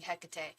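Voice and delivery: Hecate with the weight up front, HEK-uh-tay; stress on first syllable. Shown as a demonstration. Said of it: "putting the emphasis on the wrong syllable".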